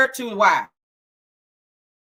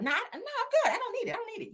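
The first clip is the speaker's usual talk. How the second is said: high, soft, fading refusal; higher mock voice, trailing quieter; 10 semitones higher, 7 dB quieter, faster than usual. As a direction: refuse the gum in a high, shrinking voice. Mimicking someone refusing gum.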